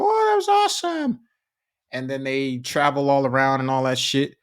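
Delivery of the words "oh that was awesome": much higher imitation of a thrilled kid; mock kid cheering, far higher pitch; excited kid voice. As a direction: squeak it out like a delighted child. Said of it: "the kids go".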